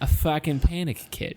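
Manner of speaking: put-on thick Boston accent, tough guy. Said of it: "Boston accent that we can do".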